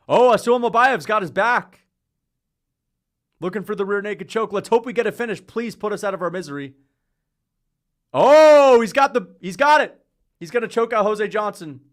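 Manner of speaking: loud, high, hyped play-by-play; real thrill at a near finish; excited fight commentary. Real, not acted. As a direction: call the choke like it's happening live. Watching fight, big takedown moment.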